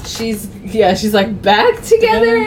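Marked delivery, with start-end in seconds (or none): 1.4-2.5 high, incredulous repeat of the news; a retold yelp of disbelief. Jane hears the couple reunited, high disbelief.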